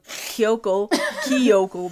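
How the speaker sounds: "Kyoko" said fast, ending like "Kyokel"; rushed Kyoko. Real, not acted. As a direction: rush "Kyoko" so the end sounds like L. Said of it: "I say it so fast, so it sounds like an L".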